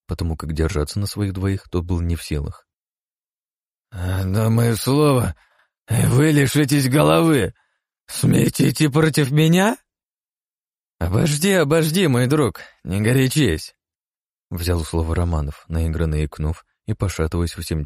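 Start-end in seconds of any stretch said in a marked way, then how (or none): 3.9-9.8 put-on high, loud, slow drunken threat. Drunk Osman threatens guards. 11.0-13.7 fake drunk. Roman feigns drunkenness.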